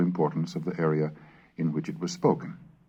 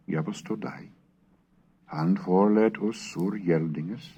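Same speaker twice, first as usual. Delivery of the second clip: Middle English recitation; 4 semitones higher than usual. Acted. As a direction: intone it like a medieval scribe reading his Pater Noster. Reads a Middle English prayer aloud.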